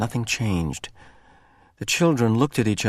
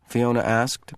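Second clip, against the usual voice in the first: ordinary delivery, nothing marked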